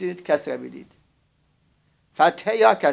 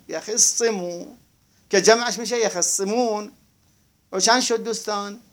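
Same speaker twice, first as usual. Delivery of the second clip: ordinary delivery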